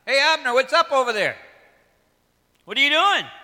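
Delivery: cocky shout to a distant commander; calling out. Acted. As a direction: hail him from the far hill. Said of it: "he called out".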